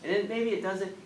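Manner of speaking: usual speaking voice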